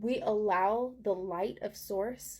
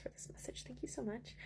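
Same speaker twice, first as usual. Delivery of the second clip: whisper; 15 dB quieter than usual. Real, not acted. Thanking Gaia for grounding.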